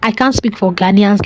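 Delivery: ordinary talk, nothing marked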